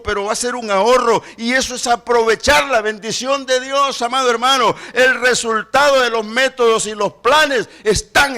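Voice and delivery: loud, driving preacher voice; full-force preaching. Acted. Preacher urging the church to tithe, loud preaching. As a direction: preach it to the congregation.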